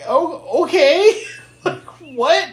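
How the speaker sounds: high, laughing mock confusion; baffled disbelief. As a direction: sound totally thrown by the nonsense. Reacting to absurd plot, laughing.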